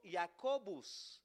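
Jacobus drawn out slowly, every syllable clear; Latin name, repeat after me. Shown as a demonstration. Preacher slowly modelling Jacobus for the congregation. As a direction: stretch Jacobus out slowly for the class. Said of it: "Diga conmigo".

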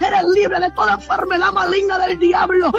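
ordinary delivery

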